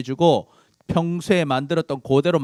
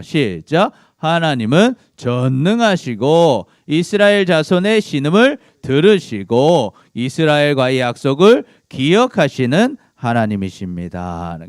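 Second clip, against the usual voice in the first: slow, louder read-aloud summary; slow group reading; 5 dB louder than usual. Real, not acted. Teacher leading the class in reading the summary, slow and loud. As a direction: read it out slowly for the class to follow. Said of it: "우리 정리한 거 읽어볼까요?".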